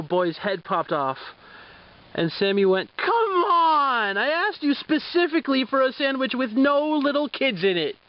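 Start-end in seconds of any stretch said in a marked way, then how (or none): 3.0-8.0 exasperated whale. Acted. Sammy complains about sandwich again.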